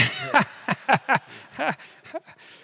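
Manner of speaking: big bright burst of laughter; loud laugh. Real, not acted. Host cracking up at his blunt joke, loud laugh. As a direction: laugh out loud, high and free.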